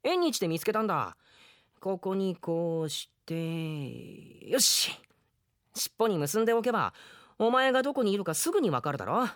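put-on bright young boy handing over a present; a cheerful kid voice, lightly acted; lively boy character. Drama scene, boy explains bell.

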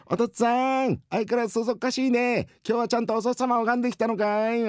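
put-on very high child's shout; calling little boy. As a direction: call up to Dad like a teasing kid. Boy shouts to absent-minded father.

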